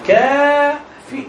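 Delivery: كافي stretched very slowly, each sound drawn out; drawn-out spelling. Shown as a demonstration. Teacher to student, drawing out كافي slowly. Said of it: "فين الآي؟".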